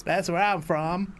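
slow, hammed-up Deep South American drawl; slow put-on American South accent. Shown as a demonstration. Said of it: "from the deep south".

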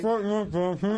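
mouth full. Real, not acted. Marshmallow challenge, mouth stuffed.

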